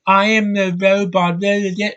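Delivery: sneering robot impression of a bad performance; mock robot. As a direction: imitate a lifeless robot actor. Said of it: "When you sound like this".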